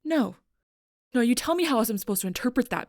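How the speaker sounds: loud, high, fast retort; angry protest. Acted. Demanding an explanation from the other, heated and loud. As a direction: cut in hard and rush the challenge.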